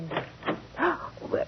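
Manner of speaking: sharp, frightened intake of breath; startled gasp. Acted. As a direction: gasp as someone suddenly appears at the door. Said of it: "I didn't mean to frighten you".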